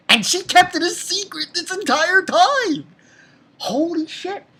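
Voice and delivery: high-pitched, astonished exclamation; floored by the reveal, voice way up; amazed outburst. Real, not acted. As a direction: burst out high in disbelief.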